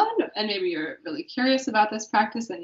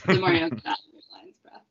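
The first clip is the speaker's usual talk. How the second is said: ordinary talk, nothing marked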